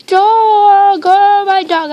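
loud, high, drawn-out cry of delight; thrilled, swooning outburst; overwhelmed excitement. Real, not acted. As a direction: wail it out, dying of cuteness. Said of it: "I'm dying right now".

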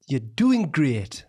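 drawn-out Geordie accent, very slow; Geordie model. Shown as a demonstration. Coaching someone through the accent, slow clear Geordie. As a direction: stretch it out slowly in Geordie.

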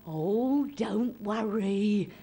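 put-on slow, sugary old auntie; old relative. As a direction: coo like a doting old relative. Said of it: "every old relative came up, right, and went".

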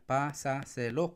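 "pásaselo" slowly as pá, sa, se, lo; split into syllables. Shown as a demonstration. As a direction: count out pásaselo in four pieces. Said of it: "cuatro sílabas".

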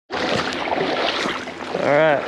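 usual speaking voice

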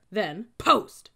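loud command exploding on the last word; barking. A narrator's reading. Cadet ordering unmoving recruits away, barking. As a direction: send them off with a bark.

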